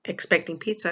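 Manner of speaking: ordinary talk, nothing marked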